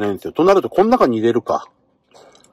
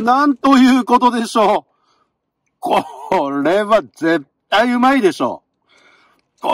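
much higher, eager exclamation; hyped up; 6 semitones higher than usual. Real, not acted. Marveling at his ponzu fillet, excitedly much higher. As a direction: gush over the food like a TV reveal. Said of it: "テンション上がっちゃってますね".

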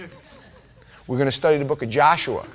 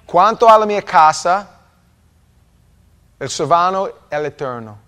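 loud, hammed-up Italian accent; 4 semitones higher, 12 dB louder than usual. Shown as a demonstration. Preacher reading his Italian plaque to the church, showy Italian accent. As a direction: declaim it with a showy Italian lilt. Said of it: "say it with a little Italian accent".

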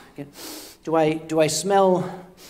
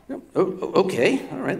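ordinary delivery

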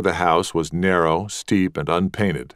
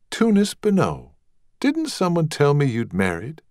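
higher, lightly voiced young belle; young lady; 8 semitones higher than usual. Rosemary questioning Tunis, higher lightly voiced belle. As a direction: ask it brightly.

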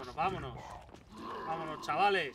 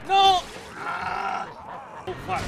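sudden desperate yell; panicked shout; 14 dB louder, much slower than usual. Real, not acted. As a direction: cry out as the hit lands. Dying in game.

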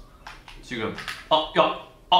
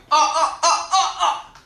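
laughing; 5 dB louder, slower than usual. Real, not acted. Laughing at near miss.

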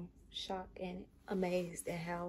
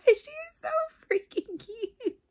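much higher, louder squeal of joy; laughing high-pitched squeal; delighted squeal; 12 semitones higher, 9 dB louder than usual. Real, not acted. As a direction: squeal like seeing a newborn. Gushing over the doll's cuteness.

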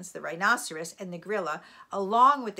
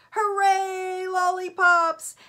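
high, loud, drawn-out cheer; excited cheer; 11 semitones higher, 10 dB louder, much slower than usual. A narrator's reading. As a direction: cheer like a crowd of delighted crocodiles. Crocodiles cheering at lollipops.

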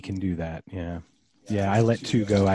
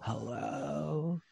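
rising lilt, creaky fry; Valley Girl uptalk and fry; uptalk with vocal fry; 11 dB quieter, slower than usual. Shown as a demonstration. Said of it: "upspeak and vocal fry is like".

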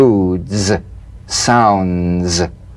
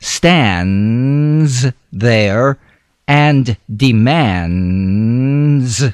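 slow, deliberate, broken up; slow phrased; 2 semitones higher, slower than usual. Shown as a demonstration. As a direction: stretch each phrase out. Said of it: "Feel the z in these sentences".

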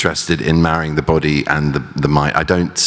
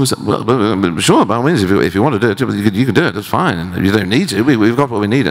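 bad Kiwi accent, raised pitch; Peter Jackson Kiwi accent; 5 semitones higher than usual. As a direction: put on a rough New Zealand accent. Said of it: "I will attempt a bad Kiwi accent".

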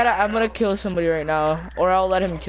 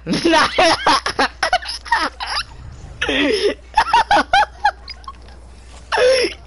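high gleeful laugh; 3 dB louder than usual. Real, not acted. Laughing after winning fight.

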